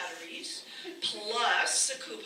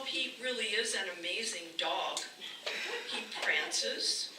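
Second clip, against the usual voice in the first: usual speaking voice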